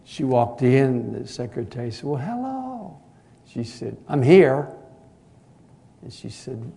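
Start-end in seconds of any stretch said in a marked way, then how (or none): 2.0-3.0 high, bright welcome; sweet secretary greeting. Retold or imitated. Secretary cheerfully greets the teenage girl, sing-song. 4.1-4.7 loud, curt I'm here; surly announcement. Retold or imitated. Sulky teenager announces herself to the secretary, curt and loud. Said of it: "surly attitude".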